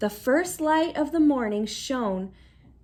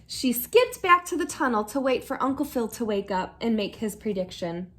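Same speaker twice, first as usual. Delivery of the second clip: ordinary delivery, nothing marked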